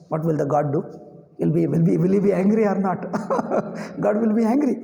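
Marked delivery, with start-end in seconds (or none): 3.0-3.9 brief high breathy chuckle; high laugh. Real, not acted. Teacher amused by his own question, high chuckle.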